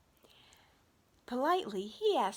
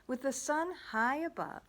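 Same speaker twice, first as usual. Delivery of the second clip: ordinary delivery, nothing marked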